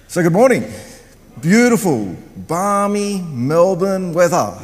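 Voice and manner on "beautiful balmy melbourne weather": slow, stretched-out words; drawn-out tongue-in-cheek delight; mock-enthusiastic. Real, not acted. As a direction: savour each word with playful irony. Joking about bad weather.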